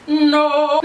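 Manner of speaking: high, loud, held "no"; exaggerated answer. Real, not acted. Vlogger answering a viewer question, loud high "no". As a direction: shout the refusal high and long.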